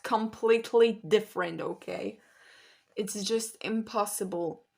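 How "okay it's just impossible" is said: ordinary talk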